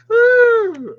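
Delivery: high loud whoop with laugh; excited whoop. Real, not acted. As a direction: whoop with glee. Excited by card.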